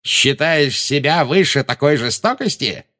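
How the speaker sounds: high, derisive villain voice; mocking. A narrator's reading. Villain mocking his enemy's mercy, derisive. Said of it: "насмешливо сощурились".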